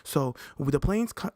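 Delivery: normal talking voice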